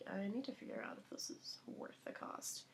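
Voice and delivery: soft, breathless inner-voice whisper; hushed self-talk; whispered thought. Retold or imitated. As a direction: whisper it like a private calculation.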